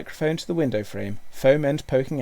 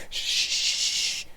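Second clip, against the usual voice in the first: long, voiceless "shh"; whispered shush. A narrator's reading. Boy quiets five excited dogs, whispered shush. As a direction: hush them with a drawn-out hiss. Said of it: "Hal whispered".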